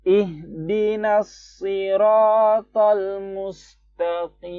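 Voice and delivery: high, slow chanted tilawah; Quran recitation. Shown as a demonstration. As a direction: chant it out slowly. (tilawah tinggi dan pelan)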